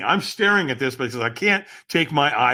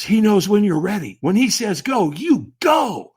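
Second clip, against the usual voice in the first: loud, high retelling of a whispered tip; urgent mock-secret message, voiced not whispered; whispered warning, retold; 5 semitones higher, 4 dB louder than usual. Stranger advising a walker in line, intense retold whisper. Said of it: "he whispers in my ear".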